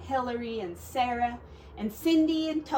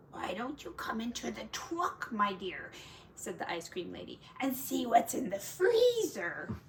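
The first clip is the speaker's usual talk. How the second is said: softer, sweet coaxing false voice; sly lady; 5 dB quieter than usual. Acted. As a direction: lure him in, sugary. Wolf disguised as ice cream lady.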